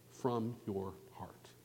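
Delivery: slow hushed whisper; slow whisper to drive the point home. Real, not acted. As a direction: lean in and breathe it to them. Preacher closes the verse.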